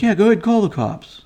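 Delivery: high, loud mock taunt of the wife; imitating the wife daring him to call police; defiant taunt. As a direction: dare him, shrill and loud. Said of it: "And she's like".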